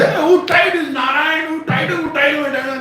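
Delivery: high, agitated repeated shouting; Balram. Acted. Brother shouts for whoever bound Krishna, high indignant yell. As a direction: fire the question over and over, high-pitched. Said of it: "So he shouted".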